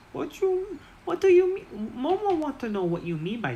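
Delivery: very high, squeaky goblin asking; high goblin confusion. Acted. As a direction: pitch way up like a puzzled little goblin. Goblin Momo asks.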